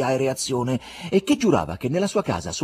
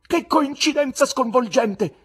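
shocked exclamation; 7 dB louder than usual. A narrator's reading. Guest shocked by Pilate.

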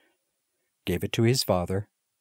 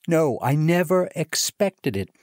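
higher and louder, leaning on never; excited disbelief, repeated; 8 semitones higher, 4 dB louder than usual. A narrator's reading. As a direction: say it again, even more overjoyed. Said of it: "with excited face".

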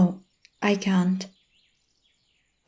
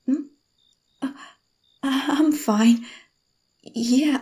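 ordinary talk, nothing marked

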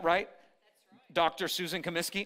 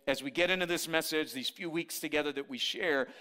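usual speaking voice

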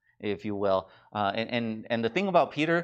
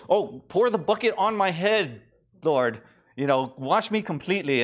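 higher, gushing plea; a lightly voiced Peter overdoing it; eager Peter; 5 semitones higher, 3 dB louder than usual.

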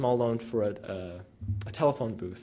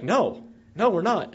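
higher and louder, a firm retold no; woman firmly contradicting the translator, raised voice; insistent loud denial; 7 semitones higher, 5 dB louder, slower than usual. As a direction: push back hard on the translator. Woman insists she is not treated equally.

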